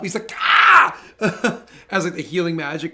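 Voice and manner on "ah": sudden loud, high cry of pain; acted scream, short and sharp; pained yelp. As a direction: yelp as an arrow is ripped out. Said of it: "he, like, tweaks up".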